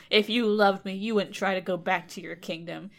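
normal talking voice

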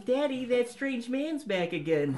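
put-on high little-boy voice; Bobby Hill voice. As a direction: do a kid whining to his dad. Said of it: "like little Bobby Hill".

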